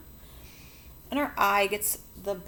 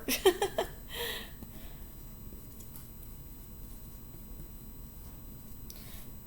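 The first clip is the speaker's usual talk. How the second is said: embarrassed laugh; 7 dB quieter than usual. Real, not acted. Laughs at her own misreading.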